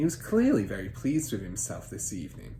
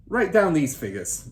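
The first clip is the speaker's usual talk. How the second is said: higher, louder command to write; bossy father; 7 semitones higher, 7 dB louder than usual. Acted. Father ordering son to take down figures, bossy. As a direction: snap the order at the boy.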